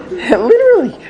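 high, gushing mock rapture; gleeful diner. Rabbi quoting the diner's rapture over his chicken, high and gushing. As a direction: rave it like a man in food euphoria. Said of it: "But he's like".

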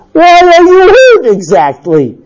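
high, loud, drawn-out challenge; exasperated husband. Acted. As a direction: demand it, voice pushed high and loud. Husband's angry quarrel, acted.

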